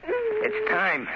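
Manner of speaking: a high cry breaking out; sudden high cry. Acted. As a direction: burst out in anguish. Killer waits for brother's execution.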